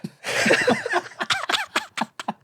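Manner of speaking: long, high helpless laugh; high laughing fit. Real, not acted. Cracking up at the co-host's joke, high laughing fit. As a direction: crack up in a high-pitched giggle.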